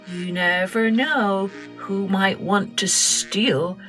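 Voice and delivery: ordinary delivery, nothing marked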